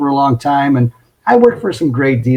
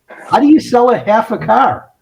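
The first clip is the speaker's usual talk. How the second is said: higher-pitched incredulous question; acted-out puzzlement, pitched up; baffled disbelief; 7 semitones higher than usual. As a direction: retell it as a bewildered rookie. Confused rookie questions his boss.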